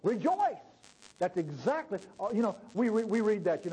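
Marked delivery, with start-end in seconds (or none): 0.0-0.6 high, ringing exclamation; joyful proclamation. Acted. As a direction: proclaim it as glad news. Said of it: "let me put that in modern English".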